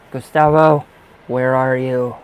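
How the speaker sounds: loud call across the room; calling out loud to a spirit named Gustavo. Real, not acted. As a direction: call his name out loud, searching.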